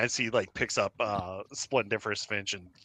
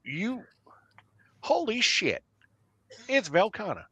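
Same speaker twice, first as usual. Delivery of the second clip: much higher, breathless amazement; awed disbelief; 11 semitones higher than usual. Acted. Cletus realizing Velkhana has grown up, awed high voice. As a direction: gasp it out like seeing a grown-up kid.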